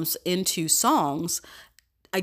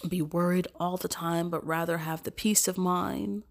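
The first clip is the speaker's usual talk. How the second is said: ordinary talk, nothing marked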